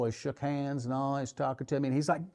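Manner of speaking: normal talking voice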